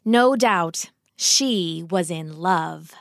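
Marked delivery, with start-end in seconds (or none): none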